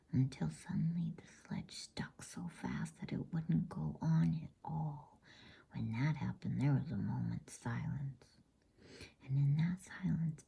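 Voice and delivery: plain reading voice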